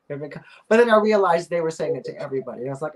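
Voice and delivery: normal talking voice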